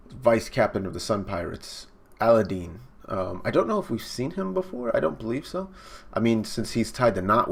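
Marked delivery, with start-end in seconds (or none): none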